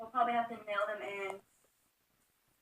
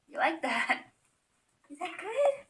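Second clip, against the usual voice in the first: coaxing pet talk; 7 semitones higher, slower than usual. Real, not acted.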